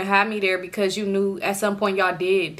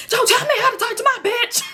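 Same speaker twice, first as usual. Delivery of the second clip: high, loud mock snarl with a laugh; mock angry boyfriend; 3 dB louder than usual. Boyfriend snapping at an older stranger, high mocking yell. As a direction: toss it back at the older man. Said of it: "And he'd be like".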